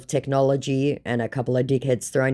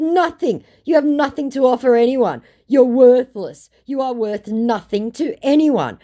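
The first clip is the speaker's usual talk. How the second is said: higher and louder, cutting; contemptuous put-down; 11 semitones higher, 6 dB louder than usual. Retold or imitated. Telling the scammer he's worthless, contemptuous. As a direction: crush him word by word.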